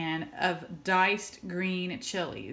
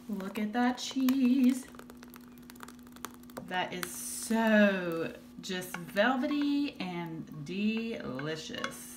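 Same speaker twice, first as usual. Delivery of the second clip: savoring; much slower than usual. Real, not acted. Admiring the melted cheese.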